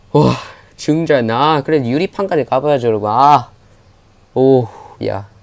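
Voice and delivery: normal talking voice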